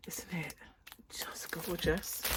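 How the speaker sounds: quiet whispered tag question; hushed whisper. Real, not acted. Crafter marvelling at yarn, whispering softly. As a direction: let it fall to a breath.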